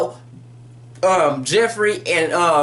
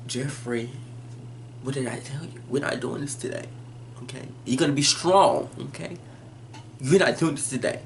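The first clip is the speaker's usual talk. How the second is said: a low, hushed telling-off under the breath; hushed scolding; 4 semitones lower, 8 dB quieter than usual. Retold or imitated. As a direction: scold him quietly so no one hears. Mother scolds son quietly.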